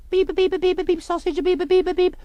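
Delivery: high-pitched mimicked bulletin beeps; vocal beep jingle. Newsreader opens the bulletin with a high beeping jingle. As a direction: beep like a squeaky news jingle.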